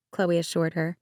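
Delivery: ordinary delivery, nothing marked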